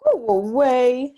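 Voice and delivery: loud, slow curt dismissal; giant wife sending off. Acted. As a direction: shoo him off the doorstep. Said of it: "she told him".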